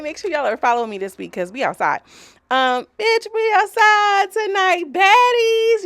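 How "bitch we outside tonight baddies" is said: high, loud and slow hype shout; rally the baddies, drawn out and loud; hype call. Real, not acted. Hyping up her followers, loud and high.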